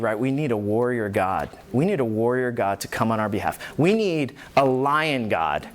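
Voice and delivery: usual speaking voice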